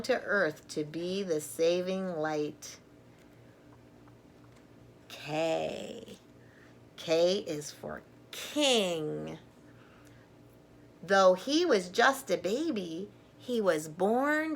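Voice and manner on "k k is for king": usual speaking voice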